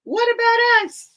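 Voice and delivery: high, loud chorus-like squeal; clamouring storybook animals. A narrator's reading. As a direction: pipe up shrilly as the left-out others. All the other characters clamour in story.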